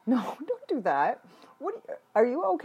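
high fast panicked; fast, high alarm. Real, not acted. Shocked reaction in game.

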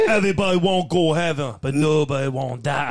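put-on Jamaican accent, slowing at the end. Friend shows off after being egged on to try it, Jamaican accent. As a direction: do a joking Jamaican movie line. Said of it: "Can you do a Jamaican accent?".

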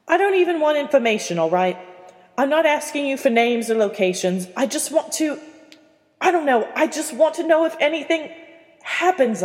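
высоко и громче, умоляюще; exasperated pleading. Acted. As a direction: push it out, frustrated and high. Percy pleads with Aberforth.

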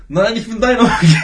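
high, loud comic comeback; mock shouted retort. Answering the friend's uncle claim, mock shouted retort. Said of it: "Und ich so".

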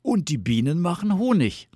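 high, loud delighted exclamation; excited call. A narrator's reading. Bear clapping paws about honey, excited call. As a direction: call it out with enthusiasm. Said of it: "rief er".

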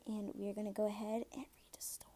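soft spooky whisper. Pretend. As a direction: hush down before the scary tale. Leading into spooky story, whispered.

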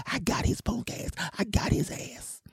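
high, whispered mock threat; slowing, hushed threat as an imitated woman; menacing changed woman's voice. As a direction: drop to a whisper in the high female voice. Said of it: "her voice went from this to this".

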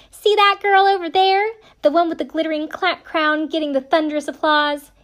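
put-on Southern accent. Retelling the show's voiceover in a Southern accent. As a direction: do a thick upstate South Carolina girl, sassy at the end. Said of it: "I'm gonna try to do the accent".

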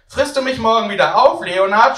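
put-on high, loud child's call across the shop; a boy yelling a question; shouting child. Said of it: "schreit er".

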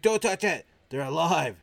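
loud, high acted warning; sudden high-pitched yell of alarm; alarmed shout. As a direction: shout it to stop someone grabbing. Acted warning not to touch brains.

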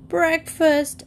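high-pitched drawn call for food; mild shriek. A narrator's reading. Rooster demanding his breakfast at the top of his voice, shrieking. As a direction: shriek it high without much volume. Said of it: "Egbert shrieked".

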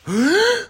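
one sudden, high, startled gasp; sharp gasp. Retold or imitated. Remembering watching the scene with her sister, a high shocked gasp. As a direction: suck in air like the shock just hit you. Stunned silence watching the film.

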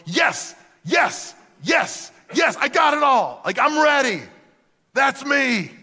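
eager bursts climbing high and loud; building excitement. Acted. Young man checking off commandments, building excitement. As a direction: fire off each one with rising excitement. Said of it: "he's just building excitement".

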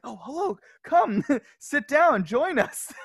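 high, loud, slowed and laughing; laughing friendly invite. Retold or imitated. Retelling how the islanders invited him, laughing. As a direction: play the welcoming islanders, giggling.

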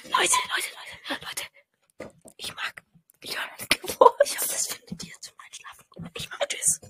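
soft unvoiced whisper, long pauses; podcast host whispering joke ASMR to listeners. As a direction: hush the listeners like a mock ASMR video. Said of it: "lass kurz A S M R machen".

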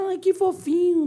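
high-pitched gushing over something cute; imitated cooing. Mocking people who find small dogs adorable, high-pitched cooing. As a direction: coo it up high, like everyone fawning. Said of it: "todo mundo acha que".

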